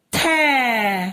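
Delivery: थै held long, gliding down; drawn-out syllable. Shown as a demonstration. Teacher showing थ with its matra, drawn-out थै. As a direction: stretch the vowel of थै. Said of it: "थ में लगी तो".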